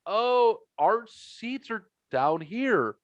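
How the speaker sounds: high, slow, drawn-out hedging; retold awkward reply. Awkwardly answers her shout.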